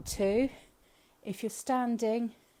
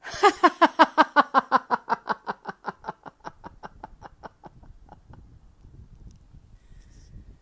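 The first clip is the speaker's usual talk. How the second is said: loud laughter pushing out the breath; forced hearty laugh; 7 semitones higher, 12 dB louder than usual. Shown as a demonstration. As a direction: laugh the air out in long bursts. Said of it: "I'm gonna demonstrate on the first one".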